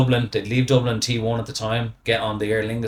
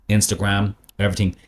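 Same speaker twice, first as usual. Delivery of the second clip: ordinary delivery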